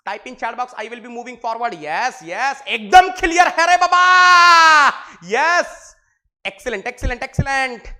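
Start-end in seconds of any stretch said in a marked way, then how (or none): 1.8-7.9 thrilled shout. Real, not acted. Shouting approval at chat replies.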